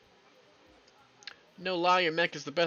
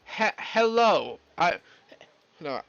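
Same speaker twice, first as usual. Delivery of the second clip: hesitant greeting; 3 semitones higher, 7 dB louder, much slower than usual. Acted. Character nervously trying English hello, halting and slow. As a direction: stumble shyly into English.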